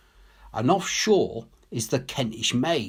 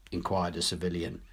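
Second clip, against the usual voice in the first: usual speaking voice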